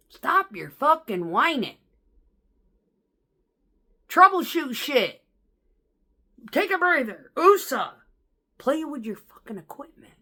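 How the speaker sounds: loud, high, slow chewing-out; fed-up telling-off. Retold or imitated. Driver telling the whining coworker to troubleshoot, loud and fed up. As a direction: lay into him, fed up.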